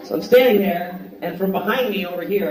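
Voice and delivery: usual speaking voice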